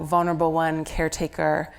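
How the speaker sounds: normal talking voice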